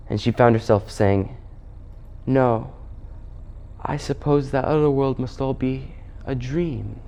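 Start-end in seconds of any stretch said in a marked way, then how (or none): 2.3-7.0 softer, anger gone, lulled; hint of a girl falling under a spell; Jill. Jill enchanted, anger left her, dreamily giving in.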